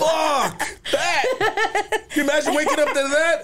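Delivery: high laughing rant. Real, not acted.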